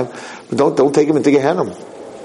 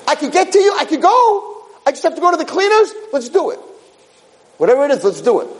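very high falsetto, eager and bright in pitch; a small soul straining toward the wedding; eager neshama voice. Acted. The neshama agreeing to go to the cleaners, in a high squeaky voice. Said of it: "says the neshama".

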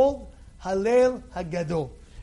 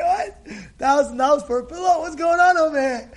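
higher-pitched, quick disbelief; incredulous; 4 semitones higher, 4 dB louder than usual. Acted. Shopper shocked by a pillow's price, incredulous. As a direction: act out outrage at a price.

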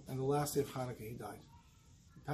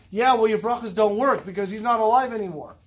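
louder, higher defiant jab; chutzpah retort; 5 semitones higher, 7 dB louder than usual. Retold or imitated. Son retorting to the Dayan about failed blessings, defiant. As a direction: throw it back at him with cheek. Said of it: "the chutzpah, to speak like that".

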